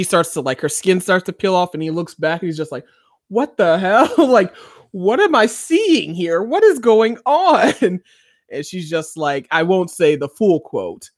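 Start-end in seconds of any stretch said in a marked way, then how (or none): 3.3-8.0 high, slow, stunned and laughing; shocked disbelief. Retold or imitated. Man watching her skin peel off, retold in stunned disbelief. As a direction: retell his baffled horror with a laugh.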